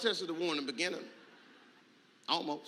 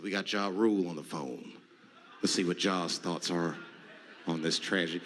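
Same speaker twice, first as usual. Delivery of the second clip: TV announcer voice; 12 semitones lower than usual. Retold or imitated. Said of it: "They said".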